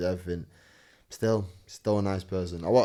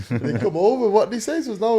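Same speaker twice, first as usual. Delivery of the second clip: usual speaking voice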